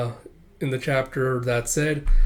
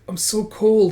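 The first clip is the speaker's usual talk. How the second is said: high, louder pained voice; suffering complaint; 8 semitones higher, 5 dB louder, slower than usual. Acted. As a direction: moan it high, like the wounded. Wounded soldier suffering alone.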